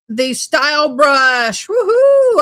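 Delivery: high, loud hammed-up woohoo; cheering in a put-on girly voice; mock cheer. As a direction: celebrate the prize over the top. Cheering within read-out letter.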